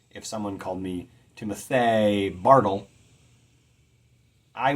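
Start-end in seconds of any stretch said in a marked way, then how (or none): 1.4-2.9 Timothy Bartle said as tim-o-THAY BAR-tle; deliberately misplace the stress in Timothy Bartle, louder; mispronounced name. Shown as a demonstration. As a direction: draw out Timothy Bartle wrongly, landing hard on THAY and BAR. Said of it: "someone pronounces our name wrong".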